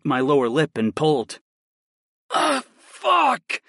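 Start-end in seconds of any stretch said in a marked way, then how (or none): 2.3-3.6 high strained groan. Acted. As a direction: cry out in pleasure. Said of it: "I groaned".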